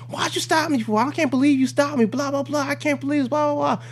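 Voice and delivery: high-pitched mock outraged woman; hostile driver. Hostile driver griping at the cop who stopped her, mock high-pitched outrage. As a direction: rattle off a squealed, hammed-up complaint. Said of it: "immediately she's hostile".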